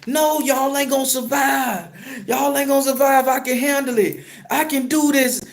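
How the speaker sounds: high, wailing plea; acted bawling. Driver begging crew to let him save them, acted bawling. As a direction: plead tearfully in a high acted cry. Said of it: "I'm bawling".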